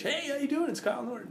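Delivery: overly chirpy cold-call salesman; Johnny Sales Pitch voice. Retold or imitated. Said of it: "not be on a call, Johnny sales pitch".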